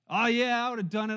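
loud, proud burst: of course I would; indignant outburst. Retold or imitated. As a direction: blurt it out loud and offended. Naaman answers his servant indignantly.

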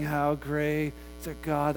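soft, slow, fed-up drone; weary. Retold or imitated. Churchgoer tired of the old song, weary drone. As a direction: sigh it out, sick of the song. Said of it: "How many times are they gonna sing".

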